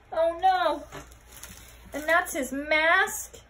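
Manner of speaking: ordinary delivery, nothing marked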